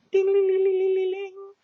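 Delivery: very high sing-song ringing noise; imitated phone ring.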